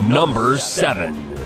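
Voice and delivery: slow, dramatic announcer voice; drawn-out countdown announcer call; top ten countdown. Acted. Top ten list countdown card.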